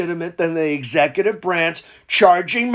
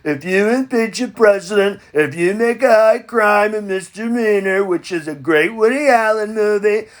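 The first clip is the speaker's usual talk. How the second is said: normal talking voice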